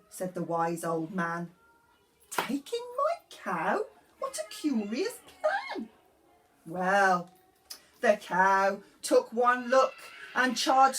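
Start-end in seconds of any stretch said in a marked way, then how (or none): 2.3-5.9 put-on high, pleading old lady voice; a fretful little old lady begging for help. Old lady's reply.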